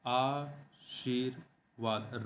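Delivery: very slow, soft "आशीर्वाद" as aa, sheer, vaad; syllable by syllable. Shown as a demonstration. Teacher sounding out the correct spelling, slow split "आशीर्वाद". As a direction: draw out "आशीर्वाद" in separated syllables. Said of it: "आशीर्वाद होता है".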